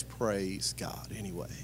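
hushed praise. Retold or imitated. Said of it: "he always says".

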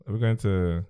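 ordinary talk, nothing marked

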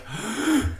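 a sharp amazed intake of breath; mock wonder gasp, breath sucked in. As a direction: gasp like the thing just moved. Watching the plunger shrink.

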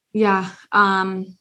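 usual speaking voice

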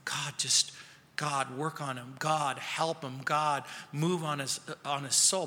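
low, soft pleading prayer; quietly intercede, in halting phrases; hushed prayer on another's behalf; praying for someone. Retold or imitated. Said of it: "began to pray for me".

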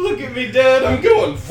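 put-on high, loud gleeful child voice; excited kid. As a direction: squeal it like a proud little kid. Kid voice bike joke.

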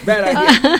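bad idea repeated through a laugh; amused rueful repeat. Shown as a demonstration. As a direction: laugh it out the second time.

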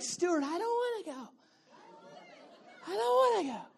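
put-on high, soft whining kid voice; whiny child. Congregation voiced as a whining child, high and soft. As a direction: whine like a reluctant child.

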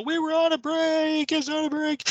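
high, whiny sitcom protest; Ross from Friends. Retold or imitated. Host mocking Ross's famous break line, high and whiny. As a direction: squeal it like Ross insisting on the break. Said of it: "Q Ross from Friends".

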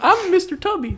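high, squeaky put-on kid voice, laughing through it; silly kid voice. Joking with his cohost, playing a squeaky little kid. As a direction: pitch it way up like a goofy little kid.

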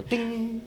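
high chiming ding like a bell. Pretend. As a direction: ring it out like a little bell. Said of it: "we'll have the little bell on our show".

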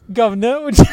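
high, hammed-up British porn voice; playful mock-British come-on; mock British accent. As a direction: put on a cartoonish British accent, pitched high. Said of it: "Not into British chicks".

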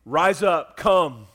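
Jesus calls the dead man out, loud command. Acted. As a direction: call Lazarus loudly from the tomb. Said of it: "Jesus ... said".

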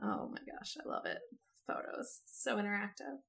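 soft delighted whisper; delighted gasp. Real, not acted. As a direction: breathe it out, thrilled. Reacting to getting a photo.

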